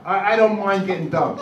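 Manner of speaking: normal talking voice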